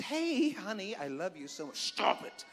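mock tender, then a hushed stop it; sweet-voiced warning. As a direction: coo it sweetly while hushing a child. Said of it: "put your hand on their thigh".

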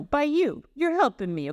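lightly put-on Italian-American accent; a touch of American Italian. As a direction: talk like an Italian-American uncle. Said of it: "this is more American Italian".

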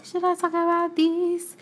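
high, put-on singsong question; hesitant wondering. Real, not acted. Repeats the question, putting it on.

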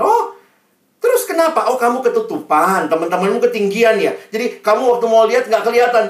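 loud, high acted teacher voice; questioning teacher. Teacher guesses why pupil cries, loud questioning. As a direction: guess aloud, urgently, at the cause. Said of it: "gurunya datang sama dia".

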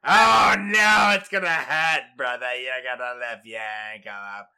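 a loose put-on New York accent, loud at first; imitating a New Yorker, half-hearted; hint of New York accent; mock New York accent. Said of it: "Now I'm getting to New York".

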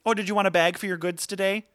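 high bright cashier voice; sweet overly polite shop clerk; customer service voice. Retold or imitated. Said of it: "my customer service voice".

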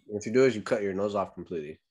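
normal talking voice

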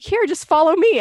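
high, loud little goat voice; friendly goat guiding a lost hiker, high and loud; goat's voice. Retold or imitated.

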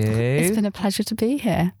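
put-on light French accent on English; polite guest in a French accent. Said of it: "good job on not dropping your French accent".

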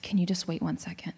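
hushed whisper to her daughter; whispered aside. Retold or imitated. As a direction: whisper so only the child hears. Asking daughter to wait quietly.

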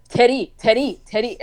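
put-on repeated "Teddy" for three in mom's accent; "Teddy" as accented three, tapped r, over and over; mispronounced three. Daughter imitating mom retrying three, accented "Teddy". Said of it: "And she's like".

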